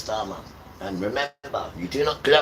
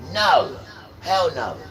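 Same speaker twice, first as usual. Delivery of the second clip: loud refusal; 5 semitones higher, 4 dB louder, slower than usual. Real, not acted. Preacher rejects idols.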